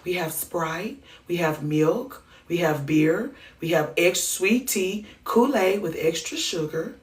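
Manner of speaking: soft, sweet offer of drinks; gentle voice. Acted. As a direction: sweetly offer every drink but water. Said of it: "in such a gentle voice".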